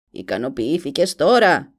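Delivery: slow, loud, gloating witch; malicious smile. A narrator's reading. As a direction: sneer it with an evil grin. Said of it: "χερέκακο χαμόγελο". (κακεντρεχής μάγισσα)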